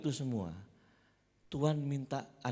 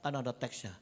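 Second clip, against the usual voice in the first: normal talking voice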